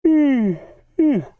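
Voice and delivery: loud, high yawn. Real, not acted. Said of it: "that yawn came out of nowhere".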